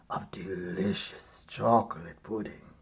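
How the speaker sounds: hushed, tempting whisper; savoring whisper. A narrator's reading. Narrator to listeners, describing the pudding in a tempting whisper. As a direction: whisper it like a secret treat.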